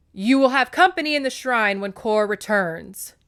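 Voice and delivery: loud, sharp scolding mother; mom voice. Acted. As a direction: snap it like a strict mom. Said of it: "I'm having to get into my mom voice there for Demeter".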